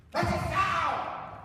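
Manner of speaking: high, drawn-out yell at the ref; mock parent yell. Parent in the stands yelling at the referee. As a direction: shout it like an outraged parent.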